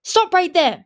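high, loud warning shout; urgent, forceful command; crying out. A narrator's reading. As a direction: shout to halt an intruder. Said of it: "she cried".

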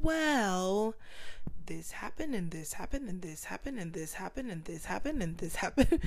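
rattling off one trouble after another, each line rising; listing troubles. Retold or imitated. Telling her mentors every trouble, rising list. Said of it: "I'm like".

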